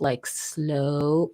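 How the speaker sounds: SLOW said with a long, lingering vowel; drawn-out slow talk. Shown as a demonstration. Host practising talking properly, drawn-out slow demonstration. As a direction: stretch out SLOW into one long word. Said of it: "I really want to talk".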